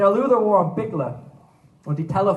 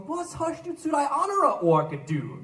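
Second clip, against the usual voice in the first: puzzled friend asking; 8 semitones higher than usual. Acted. Friend Oliver asking Luther about his burnt ear, high and puzzled. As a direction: question pitched higher. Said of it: "Er hat ihn gefragt".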